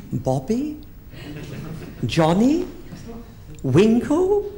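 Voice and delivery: guessing names, climbing up; rising guesses. Retold or imitated. Guessing her man's name, rising questions.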